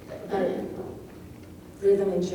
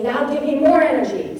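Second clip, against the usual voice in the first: more punched higher and louder; a poet urging the reader on, stress on more; voicing the poet; 5 semitones higher, 4 dB louder than usual. Lecturer voicing a poet who demands energy. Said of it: "he's saying".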